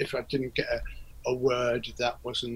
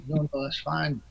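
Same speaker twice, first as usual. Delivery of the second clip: put-on light Liverpool accent, easygoing; relaxed Scouse reassurance; 3 semitones higher than usual. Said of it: "in his Liverpool accent".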